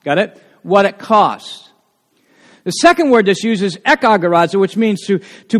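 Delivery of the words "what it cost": slow, leaning on "What" as the point; stress on what. Shown as a demonstration. Preacher drilling the key point to congregation, slow stress on what. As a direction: hit "What" and let "it cost" follow slowly. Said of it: "the emphasis is on what it cost".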